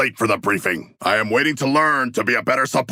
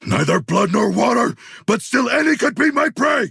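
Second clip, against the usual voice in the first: predator shout; 13 semitones higher than usual. Acted. Predator battle cry.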